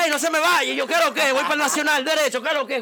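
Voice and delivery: high-pitched, frantic retold orders to a driver; urgent plea. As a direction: act out pleading with the cabbie not to leave. Urgently ordering the taxi driver onward.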